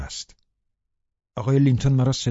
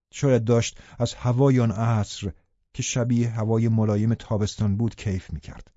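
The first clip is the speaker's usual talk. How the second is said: ordinary delivery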